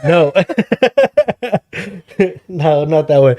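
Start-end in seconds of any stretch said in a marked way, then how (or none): 0.4-2.4 high, helpless laughter; long burst of high laughter; real laughing fit. Real, not acted. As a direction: crack up at your own slip. Cracks up at handcuff slip.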